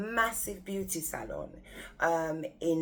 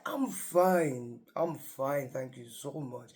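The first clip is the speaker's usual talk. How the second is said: lightly voiced deep-voiced old actor; a hint of a grand actor voice; Christopher Lee; 3 semitones lower, slower than usual. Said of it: "he's got a really deep voice".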